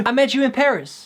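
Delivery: half-sung lyric idea; trying a lyric. Shown as a demonstration. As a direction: try the words as a hook. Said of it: "I can't unhear this as a lyric".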